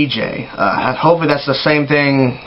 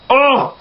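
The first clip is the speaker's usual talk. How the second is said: high, short groan of distaste; disgusted ugh; 9 semitones higher than usual. Acted. Fan dreading that the style catches on, disgusted groan. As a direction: recoil with a quick "ugh".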